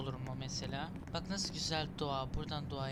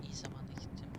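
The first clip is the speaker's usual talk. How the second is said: whisper; 3 semitones lower, 9 dB quieter, faster than usual. Real, not acted. Tense platforming moment.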